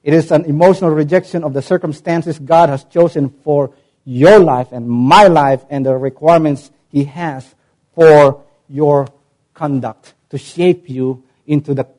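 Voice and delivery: normal talking voice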